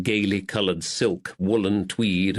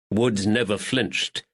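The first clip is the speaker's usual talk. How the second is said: usual speaking voice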